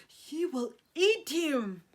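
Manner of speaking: ordinary delivery, nothing marked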